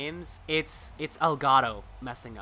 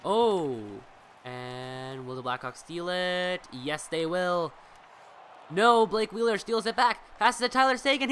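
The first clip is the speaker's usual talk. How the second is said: brisk sportscaster call, rising on the shots; live play-by-play; 3 semitones higher, 5 dB louder than usual. Acted. Streamer calling the hockey game for his viewers, lively play-by-play.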